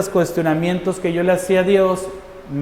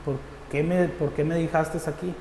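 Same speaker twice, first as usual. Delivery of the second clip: much softer, halting string of questions; retold questioning of God; 9 dB quieter than usual. Believer asks God why he is here, hushed retold questioning. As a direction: question God under your voice, restarting each why. Yo decía.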